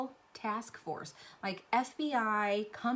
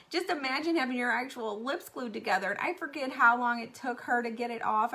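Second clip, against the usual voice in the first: normal talking voice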